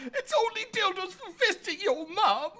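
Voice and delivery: mock crying. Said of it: "are crying".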